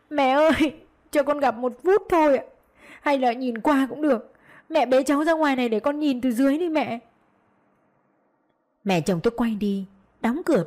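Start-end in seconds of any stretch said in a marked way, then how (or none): 0.1-7.0 high-pitched crying plea; tearful wailing. A narrator's reading. Daughter-in-law crying up to the balcony, wailing plea. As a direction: beg through sobs, voice raised. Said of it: "vừa khóc vừa gào lên".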